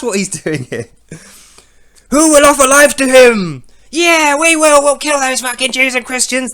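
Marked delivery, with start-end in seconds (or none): 2.1-3.6 high, very loud shout; bellowing battle summons; war cry. Acted. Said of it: "after he has done the war cry". 3.9-6.5 high, loud mob yell; hammed-up fanatical roar; mock crowd cheer. Crowd answering war cry.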